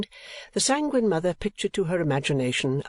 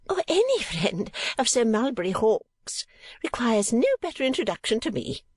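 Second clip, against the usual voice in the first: gracious; 9 semitones higher than usual. A narrator's reading. Mother welcoming a friend of Sir Mulberry, effusively gracious. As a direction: draw it out grandly in a high voice. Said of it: "observed Missus Nickleby graciously".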